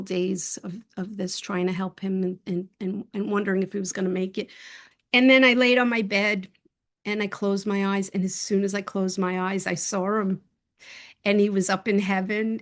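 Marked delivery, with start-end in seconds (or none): none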